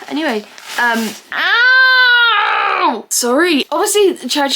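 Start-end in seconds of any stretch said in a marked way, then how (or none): 1.3-3.1 long, loud, high "ow"; pained wail. Real, not acted. Vlogger with a paper cut, wailing ow. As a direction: wail out the sting.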